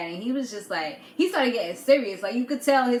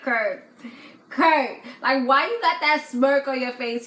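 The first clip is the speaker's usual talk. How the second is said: exasperated call-out; 3 semitones higher, 4 dB louder than usual. Retold or imitated. Vlogger confronting Kurt on screen.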